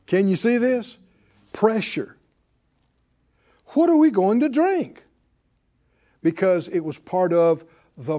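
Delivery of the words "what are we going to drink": higher, louder grumbler's demand; pressuring demand. Acted. Israelites pressing Moses for water, pressuring demand. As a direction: press the question hard, pitched up. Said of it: "This is pressure".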